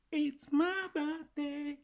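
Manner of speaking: very high, slow, sung out; high sing-song. Shown as a demonstration. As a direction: squeal it way up high. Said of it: "speaking of birthday".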